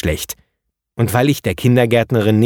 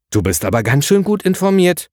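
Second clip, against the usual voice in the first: surprised; 8 semitones higher than usual. A narrator's reading. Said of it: "sagte ich überrascht".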